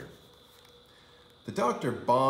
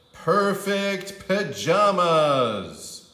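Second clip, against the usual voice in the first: slow, high, loud, drawn-out and grand; grand announcement; 8 semitones higher, 9 dB louder, much slower than usual. A narrator's reading. Narrator reading the sign, slow grand announcement.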